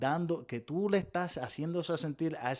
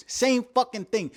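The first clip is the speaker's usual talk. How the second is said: indignant; 7 semitones higher, 7 dB louder than usual. Real, not acted. Indignant outburst.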